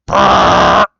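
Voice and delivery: loud blown lip-trill fart sound; a long, booming raspberry; imitated wind-tunnel fart; fart noise. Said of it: "Where it's like".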